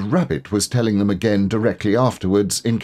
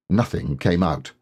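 ordinary delivery

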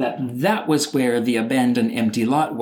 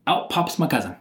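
usual speaking voice

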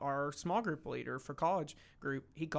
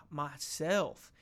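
ordinary talk

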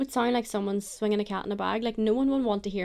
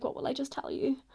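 normal talking voice

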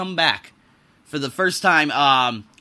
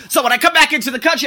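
fast, high and loud; revving up, rushing the words; worked-up rant; fired up; 9 semitones higher, 6 dB louder, faster than usual. Real, not acted. Hyped rant about airport return.

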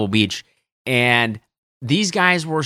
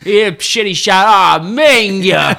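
loud, higher, drawn-out Italian-Boston curse; Italian Boston accent; 6 semitones higher, 6 dB louder, slower than usual. Retold or imitated. Caddie imitating golfer after shitty shot, loud Italian-Boston accent. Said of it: "his Italian Boston wicked pissa".

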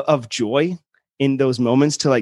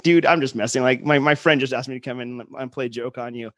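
ordinary talk, nothing marked

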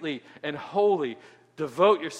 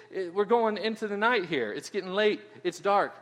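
usual speaking voice

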